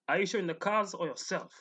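normal talking voice

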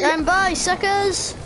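gleeful high taunt. Pretend. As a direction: jeer at them on the way out. Leaving teammates behind, taunting.

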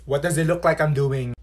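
ordinary talk

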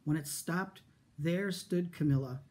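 usual speaking voice

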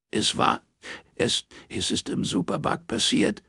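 high, breaking, halting voice; acted sobbing on the phone; tearful account. A narrator's reading. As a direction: struggle to speak through tears. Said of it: "Ein herzzerreißendes Schluchzen drang aus dem Hörer".

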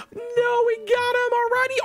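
very high-pitched excited squeal; squealed protest. Real, not acted. Insists they already won.

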